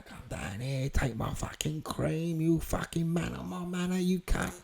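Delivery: acted, held-back aggression; retold threat. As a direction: menace them without raising the volume. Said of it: "I threatened them".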